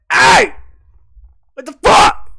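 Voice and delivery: sudden high, loud shout at the stranger; angry yell. Retold or imitated. Man slaps their car window, so he yells at him. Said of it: "You know, I start yelling".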